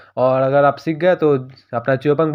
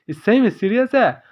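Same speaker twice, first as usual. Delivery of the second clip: shocked; 7 semitones higher than usual. A narrator's reading. Shocked at being told go down.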